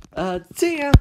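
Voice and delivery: high, loud parting yelp; high-pitched getaway shout; hasty goodbye. Acted. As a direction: toss it out loudly as you flee. Acted fleeing from a chaser.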